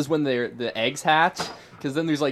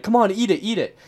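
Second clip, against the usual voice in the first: higher, louder goading from the crowd; egging him on; 6 semitones higher, 5 dB louder than usual. Retold or imitated. Kids at lunch daring a boy to eat the wasp, egging him on. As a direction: urge a friend on, like a chant. Retold peer pressure at lunch.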